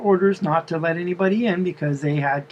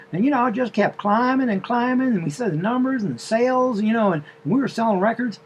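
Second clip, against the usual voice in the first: higher, chatty southern drawl; 6 semitones higher than usual. Retold or imitated. The wife telling the driver her story in a southern drawl. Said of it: "a southern drawl".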